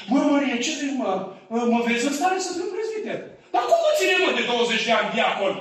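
hams up the vain deacon asking for promotion; mock ambitious deacon. Deacon's ambition, voiced mockingly.

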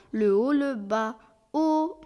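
teacher belts out the "O"; round O demo. Acted. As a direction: round the lips into a full "O". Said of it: "La voix O se forme en rouvrant les mâchoires".

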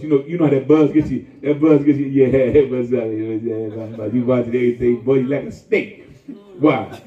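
ordinary talk

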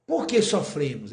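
ordinary talk, nothing marked

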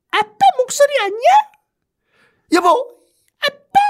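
put-on very high, fast childlike questioning; small girl calling for her dad; little daughter voice. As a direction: squeak out a hopeful child's call. Daughter recognizes father's voice.

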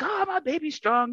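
high-pitched imitation of an excited mom; doting mom voice. Excited mom praising her son over his squat video, mock doting. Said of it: "doing the mom thing".

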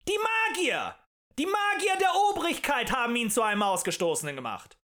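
loud, high-pitched denunciation; outraged rabble-rouser; furious accusation. A narrator's reading. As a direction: hurl the blame before a crowd. Agitator accuses the magicians.

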